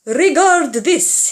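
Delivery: pompous maestro voice; showman. Acted. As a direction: present it like a grand performer. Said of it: "he said".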